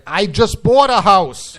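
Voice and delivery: slow, loud, high-pitched disgust. Shown as a demonstration. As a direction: drag it out in revolted disbelief. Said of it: "repeat in a disgusted tone of voice".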